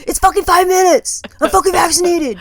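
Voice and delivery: yelling at her partner in the supermarket; retold shout. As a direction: chew him out over the mask. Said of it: "I said".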